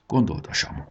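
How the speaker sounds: ordinary delivery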